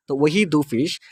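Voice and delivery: ordinary talk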